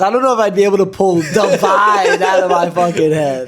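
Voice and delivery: loud laughing tease; laughing teasing. Real, not acted. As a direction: roast him while laughing. Teasing friend, laughing loudly.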